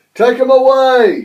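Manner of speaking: loud, high angry bellow. A narrator's reading. Sir Topham Hatt ordering Gordon out, bellowing. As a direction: roar the order over the noise. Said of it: "he bellowed".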